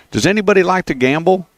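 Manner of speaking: high, lightly voiced old lady; imitating old lady neighbor. Host quoting his elderly neighbor in the driveway, high old lady voice. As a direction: play the sweet ninety-two-year-old asking a favor.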